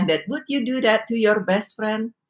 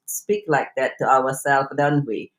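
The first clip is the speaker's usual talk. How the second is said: ordinary talk, nothing marked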